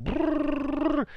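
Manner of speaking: very high, fast buzzing lip trill for nonstop talk; rapid motor-mouth babble. Retold or imitated. Speaker mocking his own rapid-fire Gary Vaynerchuk talking speed. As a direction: rattle it off like a hyper fast talker. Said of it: "'Cause I talk like Gary Vaynerchuk".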